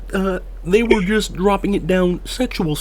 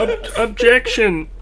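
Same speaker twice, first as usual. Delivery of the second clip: laughing objection; 7 semitones higher than usual. Acted.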